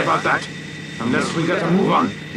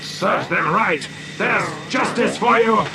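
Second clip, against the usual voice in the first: high, drawn-out crow of triumph; gleeful roar; 8 semitones higher, slower than usual. A narrator's reading. Professor crows over the star thieves, roaring gleefully. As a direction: roar it with great glee. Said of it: "roared the professor in the greatest glee".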